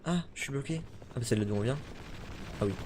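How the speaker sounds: ordinary talk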